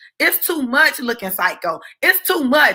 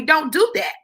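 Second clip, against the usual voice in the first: plain reading voice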